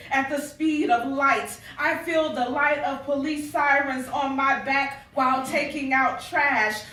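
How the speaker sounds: spoken-word piece swelling toward its last line; spoken-word poem. Acted. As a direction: build intensity line by line as a performed poem. Continues reciting her poem.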